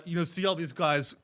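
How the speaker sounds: ordinary talk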